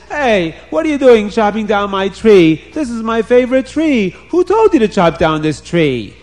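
storybook king telling men off; indignant king. A narrator's reading. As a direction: challenge them like an outraged owner. King confronts men chopping his tree.

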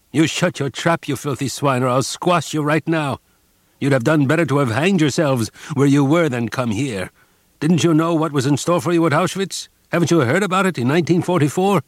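bully him into silence; furious threat. A narrator's reading. Guard threatens the prisoner who answered back, furious.

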